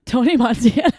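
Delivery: loud mock gangster accent; Tony Montana. Host fumbling for a Polish accent, loud mock accent. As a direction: blurt it in a put-on accent. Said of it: "I don't even know how to do a Polish accent".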